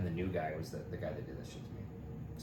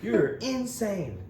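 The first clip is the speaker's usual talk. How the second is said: pissed retort; 11 semitones higher, 4 dB louder, much slower than usual. Retold or imitated. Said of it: "I was so fucking pissed".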